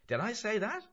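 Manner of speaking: high, loud questioning voice; surprised Mulligan. Acted. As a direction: ask it high with a rising end. Mulligan's part in dialogue.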